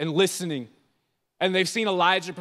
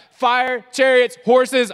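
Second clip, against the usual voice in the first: louder, higher, panicked list; mock panic; 4 semitones higher, 9 dB louder, much slower than usual. Preacher mimics a frightened listener, mock panicked. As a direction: blurt it out like a scared kid. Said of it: "raise your hand if you're like".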